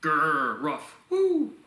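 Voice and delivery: growled "grr", a bark, then a high loud "woo"; playful animal noises rising into a whoop; expressive vocalizations. Shown as a demonstration. Lecturer demonstrating sounds versus syllables, expressive vocalizations. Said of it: "those are vocalizations".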